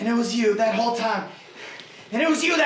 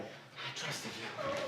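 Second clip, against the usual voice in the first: hushed and breathless, barely voiced; a betrayed son breathing the words out at his father; bitter whisper; 12 semitones higher, 21 dB quieter, much slower than usual. Son whispers to the father he trusted.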